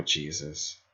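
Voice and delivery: ordinary talk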